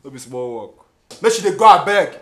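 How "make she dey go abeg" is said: high, exclaimed brush-off; exasperated outburst; loud dismissal. Acted. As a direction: wave her away with a shout.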